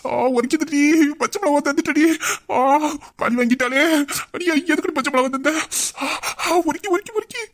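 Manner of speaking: high, whining ows from chilli burn; hammed-up pain, pitch climbing; pained wailing. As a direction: moan and accuse between gasps. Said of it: "ஐயையோ, கத்தாதயா".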